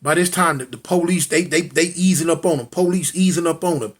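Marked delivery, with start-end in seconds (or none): none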